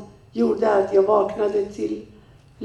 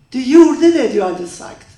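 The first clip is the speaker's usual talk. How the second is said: loud, raised-pitch accusation, ex-husband's voice; accusing shout; 3 semitones higher, 10 dB louder than usual. Retold or imitated. Ex-husband yelling at Maria in the car, accusing shout. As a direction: hurl it at her as an accusation.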